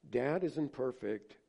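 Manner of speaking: usual speaking voice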